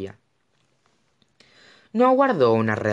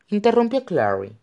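ordinary delivery

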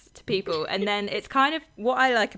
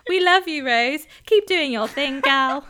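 higher and louder, cheerful celebrity shout-out; 7 semitones higher, 9 dB louder than usual. Pretend. Pretending to be [name] cheering on [name], loud and high. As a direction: beam it out like a superstar messaging a fan. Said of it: "then they'd be like".

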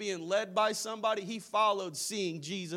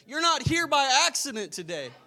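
ordinary talk

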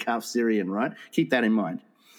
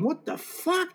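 high, softer bewildered question; acted disbelief; 12 semitones higher, 5 dB quieter, slower than usual. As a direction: let it rise in mock astonishment. Retold bewilderment as woman leaves.

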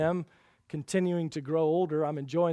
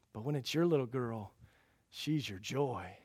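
normal talking voice

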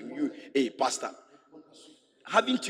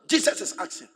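normal talking voice

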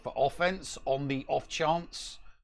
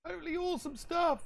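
much higher, squeaky put-on voice; silly high voice; faster than usual. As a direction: jump way up into a goofy voice. Gloating over thrift finds.

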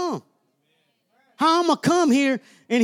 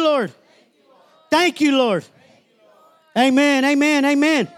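call-and-response prayer. Acted. Pastor leading the congregation in prayer, fervent. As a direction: lead the church in prayer, line by line.

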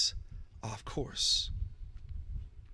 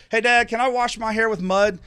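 put-on higher, louder eager child voice; pleading kid; 7 semitones higher, 7 dB louder than usual. Pastor imitating his child asking dad, high and eager. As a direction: beg like a kid at breakfast.

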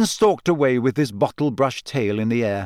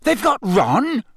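gasping alarm; 11 semitones higher, 3 dB louder than usual. A narrator's reading. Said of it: "Harry gasped".

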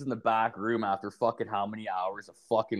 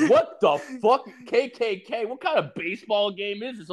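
high-pitched mock outrage, laughing at first; Kayla; 11 semitones higher than usual. Quoting a friend watching the baseball game, shocked disbelief. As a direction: imitate a scandalised friend, voice way up.

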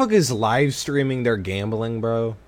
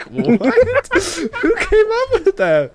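high, laughing incredulous squeal; laughing disbelief; 6 dB louder, much slower than usual. Real, not acted.

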